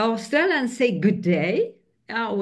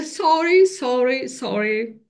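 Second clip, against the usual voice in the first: apologetic Aussie; 6 semitones higher, 3 dB louder than usual. Retold or imitated. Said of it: "if you are in their circle, they are".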